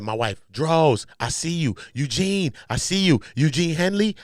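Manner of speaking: half-hearted hollering, climbing as it goes; retold screaming. Quoting what Big U says he heard, mild imitated screaming. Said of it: "He's screaming all that".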